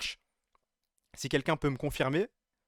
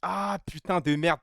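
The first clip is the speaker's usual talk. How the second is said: usual speaking voice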